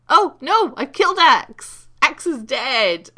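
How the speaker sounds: high, loud cry of real alarm; real startled shout; excited shout. Real, not acted. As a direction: yell it out, slowing on the last line. Accidentally kills an ally mid-game.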